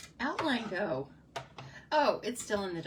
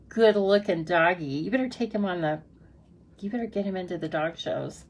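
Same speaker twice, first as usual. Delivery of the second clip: loud, gushing, faster; loud delighted doggy praise; excited praise; 9 dB louder than usual. Real, not acted. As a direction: gush over the dog on screen. Gushing over viewer's dog.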